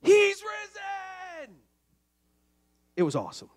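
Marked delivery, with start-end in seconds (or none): 0.0-1.6 long, drawn-out high shout; stretched triumphant cry; kids' shout. Retold or imitated. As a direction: shout it like a class of excited preschoolers. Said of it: "the whole class would shout".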